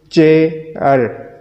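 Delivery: "चेयर" slowly as चे, pause, यर; two separate beats in "चेयर"; syllable by syllable. Shown as a demonstration. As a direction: stretch and split "चेयर". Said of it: "ये वो तरीका है".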